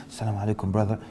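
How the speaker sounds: soft, hushed mosque greeting; whispered greeting. Retold or imitated. A brother greeting quietly in the masjid, softly hushed. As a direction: greet him in a hush. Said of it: "Immediately you start to whisper".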